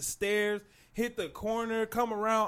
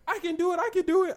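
mock mom voice; 12 semitones higher, 3 dB louder, faster than usual. Mom insisting she'll snowboard.

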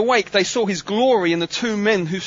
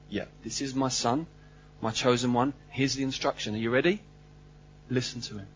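God the Father speaking gently; 8 semitones lower, 9 dB quieter than usual. Acted. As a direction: lower and softer, solemn voice of God. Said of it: "God, the Father says".

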